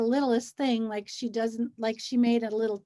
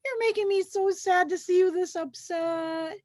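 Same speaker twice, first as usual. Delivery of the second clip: high, pleading comfort voice; mock cajoling; 6 semitones higher than usual. Anxious friend to upset person, high cajoling mock comfort. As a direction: coax someone out of being upset. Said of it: "They'll go".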